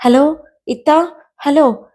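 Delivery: high, slow, drawn-out call; frightened calling. Acted. Kite phoning big sister from the dark, frightened calling. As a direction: call out with acted fear. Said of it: "അവൻ പേടിച്ചു വിറച്ചു".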